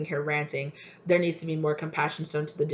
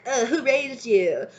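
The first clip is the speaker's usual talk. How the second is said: slow, drawn-out mocking of elders; a hint of a disapproving old-timer; mock scold; 6 semitones higher, slower than usual. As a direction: sneer it like a judgmental elder. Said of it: "they're like".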